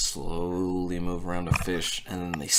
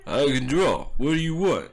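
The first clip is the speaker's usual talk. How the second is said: loud, higher, curt broken English; brusque Tomkin, louder and higher than the narration; gruff artist; 5 semitones higher, 5 dB louder than usual. Acted. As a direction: bark it out as a rough drawer. Tomkin repeats, clarified.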